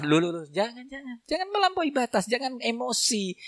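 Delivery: soft, coaxing jangan, jangan; calm him down gently; hushing restraint. Acted. Preacher calming the angry husband. (ustaz menenangkan suami dengan lembut)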